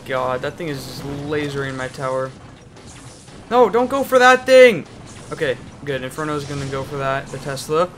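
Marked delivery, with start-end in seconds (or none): 3.5-4.9 high, loud desperate yell; frantic shout. Real, not acted. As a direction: scream at it to stop. Yelling at own troop.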